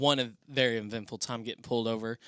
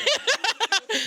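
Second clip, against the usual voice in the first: loud high laugh; 10 dB louder than usual. Real, not acted. Host laughs at speeding joke.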